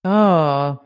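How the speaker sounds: normal talking voice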